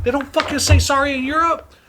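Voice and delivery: high-pitched exasperated disbelief; retold indignant comeback. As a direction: fire back in high-pitched outrage. Retold furious comeback in argument.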